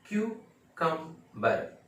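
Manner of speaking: क्यूकम्बर in three slow, separate syllables; slow correct pronunciation. Shown as a demonstration. Teacher drilling cucumber for learners, slow syllable-by-syllable demonstration. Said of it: "ये है".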